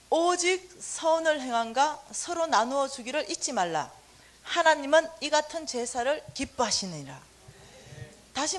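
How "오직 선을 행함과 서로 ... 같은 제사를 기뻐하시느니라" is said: scripture reading. Acted.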